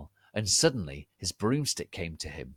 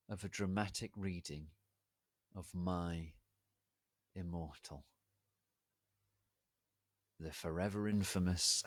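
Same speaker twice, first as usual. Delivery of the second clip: solemn outro; 8 dB quieter than usual. Acted. Host ending the dramatic reading for listeners, hushed solemn farewell. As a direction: close the reading with hushed ceremony.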